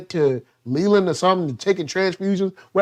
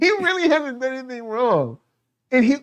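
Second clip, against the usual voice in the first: laughing; 6 semitones higher than usual. Real, not acted. Repeats it through laughter.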